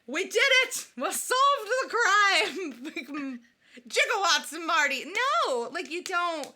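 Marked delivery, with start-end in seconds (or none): 0.1-5.0 high, loud, slow, laughing shout; hammed-up triumph. Mocking Cusack solving the crime, hammed-up shout. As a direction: yell it like a cheesy movie hero. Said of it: "John Cusack being like".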